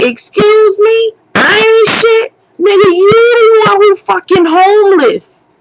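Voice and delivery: high, incredulous outrage; outraged comeback. Retold or imitated. Woman answering the man who insulted her, outraged. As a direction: throw the insult back at him in high-pitched disbelief.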